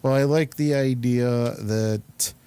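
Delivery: ordinary talk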